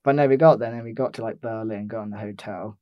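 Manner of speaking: usual speaking voice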